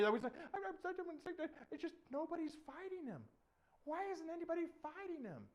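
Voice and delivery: put-on high, stammering young voice; backpedal, then plead in high pitch; squeaky, halting protest; flustered David. David stammering back to angry king.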